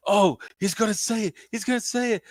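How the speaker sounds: high-pitched, excited fan reaction; retold giddy anticipation. Fan re-enacting his cinema reaction, giddy high anticipation.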